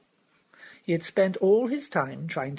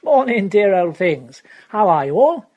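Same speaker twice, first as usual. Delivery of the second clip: Wimsey arrives; 4 semitones higher, 13 dB louder than usual. Acted. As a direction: sweep in cheerfully, greeting everyone.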